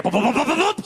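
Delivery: quick run of popped thumps; footsteps imitation. Wife storming back up the stairs, thumping noises. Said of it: "She came back up the stairs".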